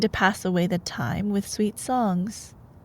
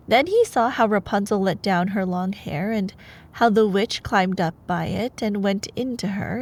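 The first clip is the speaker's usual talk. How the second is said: ordinary delivery, nothing marked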